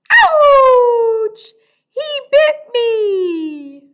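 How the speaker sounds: very high loud mock yelp, slow whine; hammed-up big hurt cry; mock ouch. Bitten by dinosaur in song.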